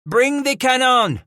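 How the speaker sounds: high, loud command; shouted order. Acted. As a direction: bark the order to the men. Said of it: "Paul Rem shouted".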